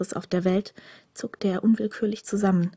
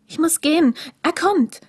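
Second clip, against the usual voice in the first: much higher and louder, hurried; the stranger must flee before he comes; urgent young stranger; 8 semitones higher, 4 dB louder than usual. A narrator's reading. Green-eyed stranger warns the prisoner, higher and louder, urgent.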